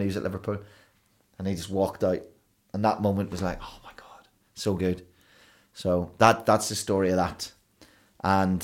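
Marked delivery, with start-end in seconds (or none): 3.6-5.1 hushed, whispered amazement; awestruck whisper. Retold or imitated. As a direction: whisper it as if overcome by the moment. Reliving an awestruck moment on stage.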